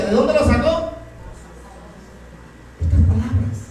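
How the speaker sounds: ordinary talk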